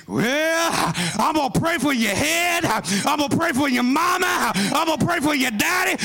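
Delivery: preacher whipping the congregation into a crazy praise; full-force preaching. Acted. Preacher erupts again into shouting.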